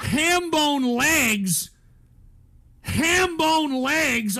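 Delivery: high, loud and slow, repeating it in disbelief; incredulous disbelief. Real, not acted. Streamer reading an insulting donation in loud, slow disbelief. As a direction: stretch each word out, shocked and offended.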